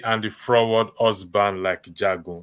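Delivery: normal talking voice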